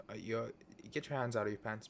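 ordinary talk, nothing marked

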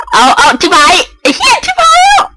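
high, near-crying yelp of dismay; panicked outcry. Real, not acted. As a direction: yelp in panic as it goes wrong. Panic as piece is taken. (ร้องโวยวายตกใจ)

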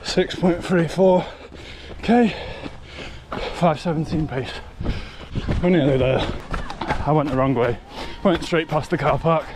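loud, gasping between words; puffing out the numbers after a run; out of breath. Real, not acted. Calling out stats at run's end.

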